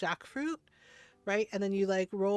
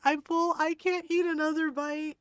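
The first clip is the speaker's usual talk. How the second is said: raised, higher-pitched imitation of protest; mock pleading protest; 6 semitones higher, 3 dB louder than usual. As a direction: imitate an overstuffed eater begging off. Imitating partner protesting he is full.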